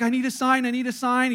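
hammed-up driver pleading; mock pleading.

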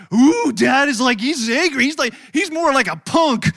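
loud mock kids' taunt; lightly voiced kids ribbing their dad; teasing kids.

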